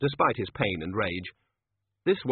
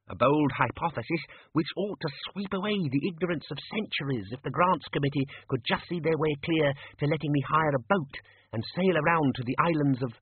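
higher-pitched earnest academic; eager junior wizard; 6 semitones higher than usual. Acted. Ponder pitches his research to his superior, lightly voiced eager scholar. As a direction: run on keenly about a pet theory.

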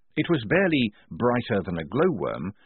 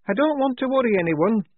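high, anxious soldier's voice; Shufti; 13 semitones higher, 4 dB louder than usual. A narrator's reading. Soldier warning comrades in the crypt, high anxious voice. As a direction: speak up high, worried by the dark. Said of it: "said Shufti".

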